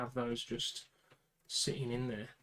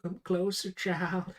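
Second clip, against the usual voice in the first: raised, louder put-on call; mock summons; 6 semitones higher, 6 dB louder, slower than usual. Calling a child closer in play, theatrical summons. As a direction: playfully beckon in a theatrical voice.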